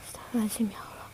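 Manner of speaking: breathless whispered astagfirullah; whispered prayer. Real, not acted. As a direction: gasp it under your breath. Shocked aside. (bisikan istighfar kaget)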